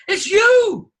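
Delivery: suddenly high, loud and held; a high, loud burst on the payoff; emphatic lift. Shown as a demonstration. Emphatic point at sentence climax.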